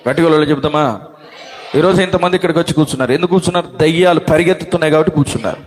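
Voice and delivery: ordinary talk, nothing marked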